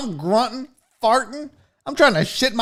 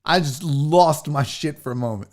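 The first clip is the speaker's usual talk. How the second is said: ordinary talk, nothing marked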